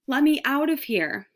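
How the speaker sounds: a mild, high plea; pleading. A narrator's reading. Mouse pleads with Garf to be freed, mildly pleading. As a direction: beg gently to be let out. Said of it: "pleaded Ralph".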